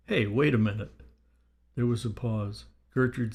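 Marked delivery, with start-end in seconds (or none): none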